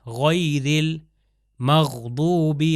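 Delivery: غیر with a throaty غ and المغضوب with a heavy ض, slowly; guttural ghain. Shown as a demonstration. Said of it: "غین اور ضاد دوبارہ آیا".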